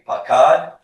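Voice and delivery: loud, high, rising cue for a class to echo; repeat-after-me model. Shown as a demonstration. Teacher leading a class drill, loud rising model word. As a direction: call the word out clearly for students to copy. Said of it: "Repeat after me".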